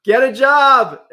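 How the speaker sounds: big, high, drawn-out joke yell; mock shout. Host jokingly plugging a job-training board, loud mock shout. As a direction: holler it like a cheeky heckle. Jokingly blunt sponsor plug.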